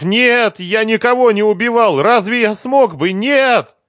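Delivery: high-pitched frantic cries; screaming. A narrator's reading. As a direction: shout your denial, pitched very high. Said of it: "закричал Лихоносов".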